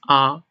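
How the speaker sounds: "a" held on a level pitch; first-tone "a", perfectly even; very flat tone. Shown as a demonstration. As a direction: keep "a" steady, no glide up or down. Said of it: "It's very flat, just".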